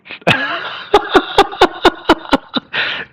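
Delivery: high airy scoffing laugh; scoffing laugh at the chart; high breathy laugh. Real, not acted. Laughing at Nvidia overheating.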